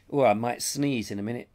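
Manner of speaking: ordinary delivery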